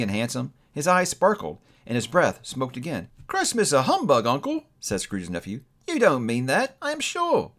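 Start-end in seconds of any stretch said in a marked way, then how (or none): 3.3-4.6 high, rising protest; lifted voice, climbing at the end; incredulous nephew. A narrator's reading. Cheerful nephew protests to Scrooge. 5.9-7.5 coaxing, climbing very high at the end; high-ending friendly disbelief; cheerful nephew. A narrator's reading. Nephew gently protests.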